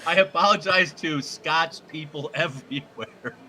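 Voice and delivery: ordinary talk, nothing marked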